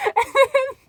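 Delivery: laughs high and loud before going on; cracking up at the line; high burst of laughter. Real, not acted. Laughing at "That's hot.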